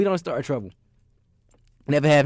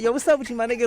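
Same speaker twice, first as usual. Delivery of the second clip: mock challenge; 9 semitones higher, faster than usual. Retold street challenge.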